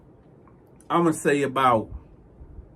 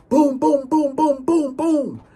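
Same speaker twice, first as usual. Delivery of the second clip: loud, higher boom boom; banging imitation; 10 semitones higher, 4 dB louder, slower than usual. Imitating the thief pounding the cell door. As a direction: mimic fists pounding a door.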